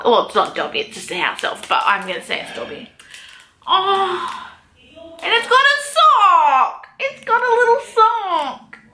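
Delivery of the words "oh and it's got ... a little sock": high, cooing delight over a tiny detail; real squealing excitement. Real, not acted. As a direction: coo at something adorable. Spotting Dobby's sock on a pin.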